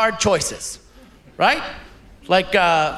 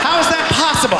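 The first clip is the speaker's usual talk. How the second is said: mock outrage; 5 semitones higher than usual. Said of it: "comic outrage".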